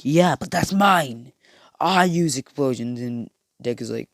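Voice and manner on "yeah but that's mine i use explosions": mock Bakugo. Bakugo claiming explosions as his own, higher. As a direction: claim it like a spoiled rival. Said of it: "Bakugo's like".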